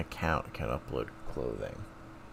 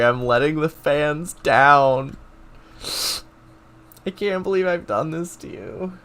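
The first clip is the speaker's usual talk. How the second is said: hammed-up apology, louder and higher; mock despair; 4 semitones higher, 6 dB louder than usual. Streamer jokingly apologises to his fans, mock despair. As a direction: overplay the shame of failing the fans.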